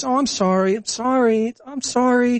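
high, sugary fake sorry; mock apology. Mocking globalists who smile and apologize, high and falsely sweet. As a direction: apologize sweetly while doing harm. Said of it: "with a smile on your face".

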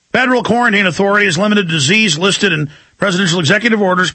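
normal talking voice

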